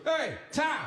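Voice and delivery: put-on high, eerie toddler greeting; mock menacing kid; menacing whisper. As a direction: creep him out, lightly. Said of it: "most menacing way".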